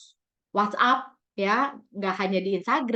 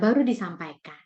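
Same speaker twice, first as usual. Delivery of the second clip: ordinary talk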